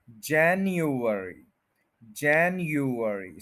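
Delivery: January slowly as JAN-yu-er-ee; slow, four-part January, stress on JAN; first-syllable stress. Shown as a demonstration. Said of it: "stress है first के ऊपर". (January, पहले syllable पर ज़ोर)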